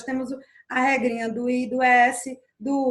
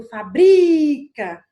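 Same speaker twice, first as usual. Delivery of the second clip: fabrica with a loud, long-held BRI; stress on BRI; 2 semitones higher, 9 dB louder, much slower than usual. Shown as a demonstration. Teacher showing where the verb stops, fabrica stressed hard on BRI. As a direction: stretch the middle syllable of fabrica, faBRIIca. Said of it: "eu parei no bri".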